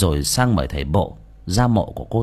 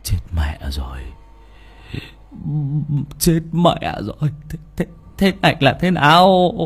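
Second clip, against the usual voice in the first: panicked stammering; 7 semitones higher than usual. A narrator's reading. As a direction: stammer in alarm, tripping on the repeated word. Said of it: "Nó lắp bắp". (lắp bắp hoảng hốt)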